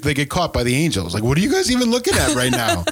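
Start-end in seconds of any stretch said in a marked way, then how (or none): 1.2-2.8 incredulous angel. Retold or imitated. Angels voiced in retelling.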